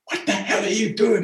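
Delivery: shocked father demanding an answer; father's exasperated outburst. Retold or imitated. Father appalled at Porterfield at the parade, high exasperated outburst. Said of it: "My father was like".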